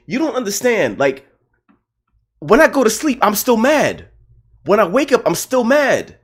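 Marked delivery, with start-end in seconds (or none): 2.4-6.2 high, loud, worked-up rant; fuming. Real, not acted. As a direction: vent the anger, pitch climbing. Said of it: "I've been fuming about this game".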